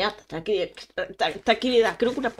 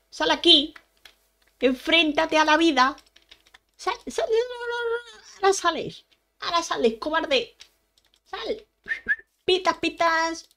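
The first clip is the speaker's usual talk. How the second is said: shouting; 6 semitones higher than usual. Real, not acted. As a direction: yell at it to come out and fight. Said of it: "no debería de chillar tanto". (gritos agudos desafiando al monstruo)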